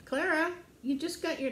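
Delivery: high-pitched talking to a dog; doggy-talk reproach; gentle pet scolding. Real, not acted.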